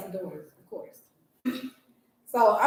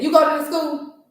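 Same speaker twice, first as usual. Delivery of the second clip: mimicked rude challenge, louder and higher; rude questioning; 7 semitones higher, 8 dB louder than usual. Lady doubts a parent belongs at school, imitated rude questioning. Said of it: "she was just rude".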